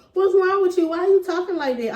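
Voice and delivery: baffled, half-teasing challenge; incredulous. Retold or imitated. Boyfriend suddenly sentimental in car.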